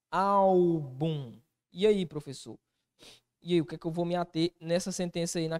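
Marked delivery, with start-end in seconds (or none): 0.1-1.4 "Álbum" drawn out on Ál; first-syllable stress. Shown as a demonstration. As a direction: hold the Ál of "Álbum" for a long beat. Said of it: "Como ela está?". ("Álbum" esticando a sílaba Ál)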